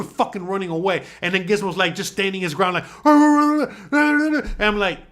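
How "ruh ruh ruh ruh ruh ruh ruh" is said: high, loud rolling snarls; sustained mock barking; imitated dog growling. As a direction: growl like a dog standing ground. Imitating dog standing ground.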